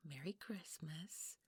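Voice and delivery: slow hushed whisper; whispered greeting. Acted. Mrs Claus sneaking into the bedroom, whispering. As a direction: breathe it so no one wakes. Creeping into a child's room.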